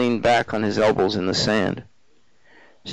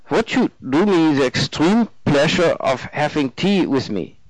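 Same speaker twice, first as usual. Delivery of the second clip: put-on courteous invitation in German accent; higher German man's voice, easing down at the end; eager German student; 4 semitones higher than usual. Narrator voices the German man.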